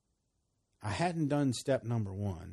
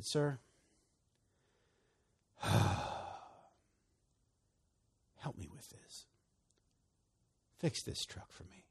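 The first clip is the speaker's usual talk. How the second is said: softer and softer, ending in a whisper; a sigh, then plead softly down to a whisper; quiet plea; 6 dB quieter than usual. Retold or imitated. Praying alone in the truck, sighing into a soft whispered plea. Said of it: "And that's how I said it".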